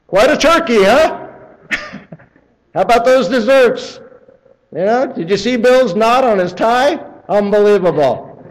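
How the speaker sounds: high, loud, fake-cheery chatter; hammed-up nervous host changing the subject; forced small talk. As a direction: play the relative steering away from God talk. Relatives diverting the conversation.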